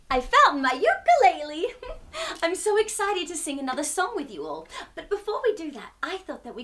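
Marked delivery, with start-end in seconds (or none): 0.1-4.7 giggly, fast excited chatter; laughing excitedly. Real, not acted. Host thrilled to find her ukulele for the kids. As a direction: bubble over with delight.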